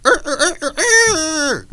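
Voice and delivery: high, squawky chicken clucks; chicken cluck imitation. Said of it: "they sound like".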